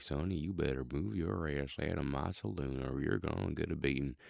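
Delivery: low Southern saloon-owner drawl; low, drawling cowboy from the South; deep Southern drawl. Shown as a demonstration. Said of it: "do a deeper Southern drawl".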